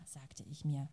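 measured literary reading to an audience; story narration. A narrator's reading. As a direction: read the novel aloud, letting the scenes carry. Literary reading after introduction.

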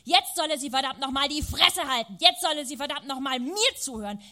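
wütend geschrien; shouting. A narrator's reading. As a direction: yell it down the phone at mother. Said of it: "schrie ich".

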